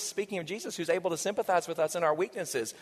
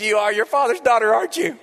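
louder, delighted teasing; excited; 4 semitones higher, 9 dB louder than usual. Retold or imitated. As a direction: boom out a proud dad's joke. Delighted reply to daughter.

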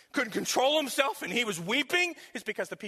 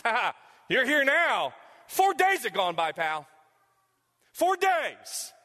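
loud, higher mocking jeer; sarcastic taunt; 4 semitones higher, 6 dB louder, slower than usual. Preacher voicing mourners taunting the late Jesus, loud sarcasm. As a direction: rub it in like a sneering critic.

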